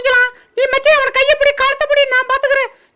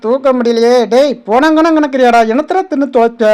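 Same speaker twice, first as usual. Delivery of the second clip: deep animal voice; 12 semitones lower than usual. Acted.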